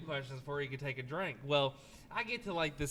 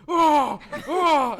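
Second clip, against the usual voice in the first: imitated groaning; 9 semitones higher, 13 dB louder than usual. Imitating friend retching in bathroom.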